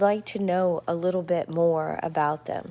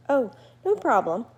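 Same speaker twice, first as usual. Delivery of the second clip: cheery bat; 6 semitones higher, 5 dB louder than usual. Acted.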